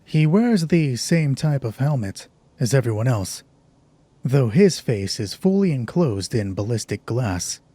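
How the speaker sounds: ordinary talk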